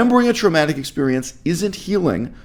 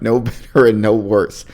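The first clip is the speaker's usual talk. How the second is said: ordinary talk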